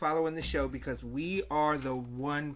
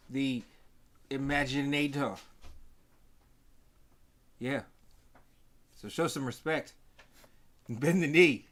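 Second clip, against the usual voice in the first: booming wrestling-promo brag; boastful; 6 dB louder than usual. Pretend. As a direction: hype yourself like a wrestler.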